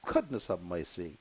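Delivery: low, fading, holding back tears; sighing out his sorrow, barely audible; choked up. Real, not acted. Host trying not to cry on air, low and fading. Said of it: "I'm too old".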